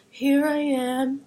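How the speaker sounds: slow, small voice answering God; Adam answering slowly, lightly voiced; timid Adam. Adam answers God's call, slow and meek. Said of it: "he's like".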